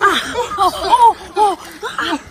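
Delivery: gasping laughs in icy water; cold gasps with laughter. Real, not acted. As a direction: laugh through the shock of cold.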